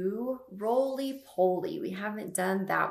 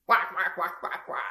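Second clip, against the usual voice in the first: duck quack. Shown as a demonstration. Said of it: "Can you all give a quack for me?".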